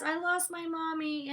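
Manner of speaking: put-on small, high, slow child's voice; high and slow, a little kid who lost her mom; little girl's voice. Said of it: "so she says".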